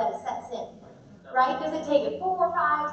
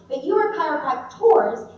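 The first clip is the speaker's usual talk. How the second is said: ordinary delivery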